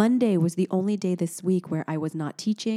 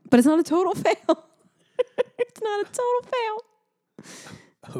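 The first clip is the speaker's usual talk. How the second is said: squeaky giggling protest; laughing, high and slow; 3 dB louder, slower than usual. Real, not acted. Defending charades to husband, laughing. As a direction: laugh it off while insisting.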